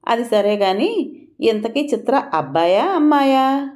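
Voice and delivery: teasing question, lifted high; sarcastic. A narrator's reading. Kamala changing the subject with a jibe, sarcastically. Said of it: "వ్యంగ్యం కనిపిస్తూనే ఉంది".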